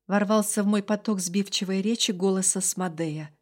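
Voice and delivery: ordinary delivery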